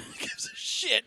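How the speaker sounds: unvoiced slow whisper; whispered echo. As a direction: breathe it back as a whisper. Echoing in whisper.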